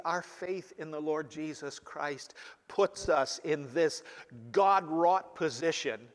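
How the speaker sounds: ordinary talk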